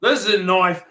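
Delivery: high-pitched mock Aussie voice; Australian accent. Showing off a knife line, high put-on Australian accent. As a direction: ham it up as an Australian. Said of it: "That's a good accent".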